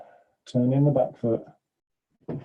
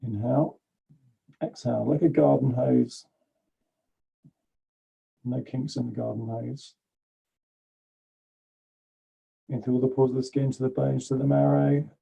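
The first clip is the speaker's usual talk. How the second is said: guided breathing. Acted. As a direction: guide the breath gently and evenly. Leads a breathing meditation.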